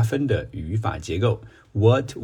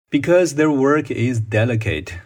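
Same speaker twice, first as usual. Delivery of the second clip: model answer reading; 5 semitones higher, 4 dB louder than usual. Shown as a demonstration. Reads model answer sentence. (慢速示范朗读)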